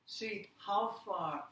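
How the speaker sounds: ordinary talk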